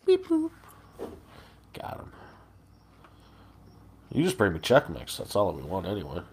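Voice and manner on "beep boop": robot beeps. Pretend. Robot noise joke.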